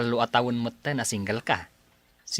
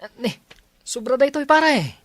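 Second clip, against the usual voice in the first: high, loud astonished protest; surprised protest; 13 semitones higher, 7 dB louder than usual. Acted. Friend protesting the money is too much, high and loud. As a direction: shoot up in disbelief at the gift.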